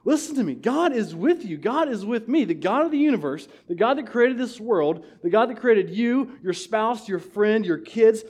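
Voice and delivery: rally the congregation with passion; impassioned pulpit cry, rising high; preaching at full force. Real, not acted.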